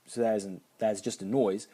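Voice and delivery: usual speaking voice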